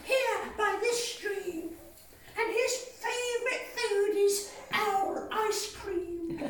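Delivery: high, bright-eyed little mouse voice; the mouse boasting sweetly; clever little mouse. Acted. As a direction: mouse teasing the owl about his favourite food. Mouse voice telling owl.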